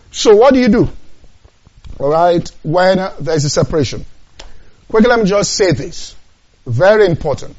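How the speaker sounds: loud, high preaching at full force; the preacher raising his voice to the congregation; fiery preaching. Acted.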